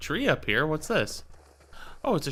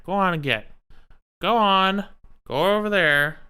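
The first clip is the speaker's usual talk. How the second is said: higher, louder scolding of a pet; shooing the cat; 8 semitones higher, 4 dB louder than usual. Real, not acted. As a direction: chase the cat away from the wires, raising your voice.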